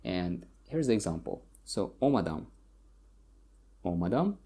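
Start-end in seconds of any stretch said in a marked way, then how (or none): 2.0-4.4 omadam stressed on the opening o; omadam, weight at the front; stress on first syllable. Shown as a demonstration. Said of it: "the stress usually falls on the first syllable".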